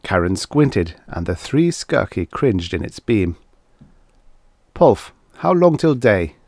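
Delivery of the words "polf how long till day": raised, mildly demanding question. A narrator's reading. Guthrie asks Polf how long until daylight, demanding. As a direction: press for an answer, pitched up. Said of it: "Guthrie demanded".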